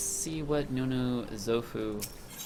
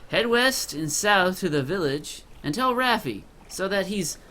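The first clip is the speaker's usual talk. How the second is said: higher, louder gruff character voice; gruff quest giver; 8 semitones higher, 6 dB louder than usual. Acted. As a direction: play a gruff villager handing out an errand, pitched up. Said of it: "I got the gruff voice from him".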